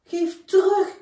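the little bear yelling at the shrew; upset shout. Acted. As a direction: call out high and loud, upset. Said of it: "riep Toby Siem".

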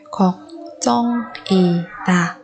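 걱정이다 slowly, each syllable apart. Shown as a demonstration. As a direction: break 걱정이다 into drawn-out syllables. Teacher models word syllable by syllable.

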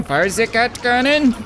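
put-on German accent. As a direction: shout the command like a cartoon German. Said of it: "I say it in a German accent".

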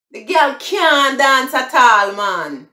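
high, loud Jamaican patois. Shown as a demonstration. Showing viewers the patois version, in Jamaican patois. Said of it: "that's the broken English".

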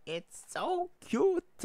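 loud, very high, drawn-out squeal; overwhelmed fangirl shriek, slow and piercingly high; gushing squeal. Real, not acted. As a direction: squeal it at full volume, stretching every word. Gushing escalates over cute panels.

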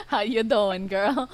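giggling through a mock greeting; laughing imitation. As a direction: laugh while greeting like the demon. Mocking demon greeting, laughing.

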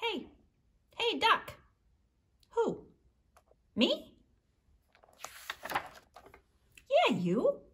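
lively read-aloud with high character voices; storytime reading. A narrator's reading. Librarian reading to children, animated character voices. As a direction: read the duck story to the kids, bouncing between voices. Reads picture book with voices.

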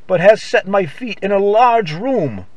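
normal talking voice

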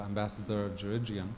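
ordinary talk, nothing marked

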